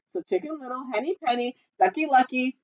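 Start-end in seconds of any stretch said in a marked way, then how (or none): none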